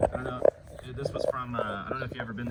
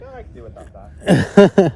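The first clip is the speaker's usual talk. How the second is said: dismissive; 5 semitones higher, 16 dB louder, slower than usual. Retold or imitated. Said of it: "I'm like".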